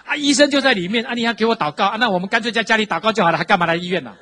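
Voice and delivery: high, loud mock incredulity; imitating dad's disbelief. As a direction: play the father, baffled and scoffing. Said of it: "他想说". (模仿父亲，高声难以置信)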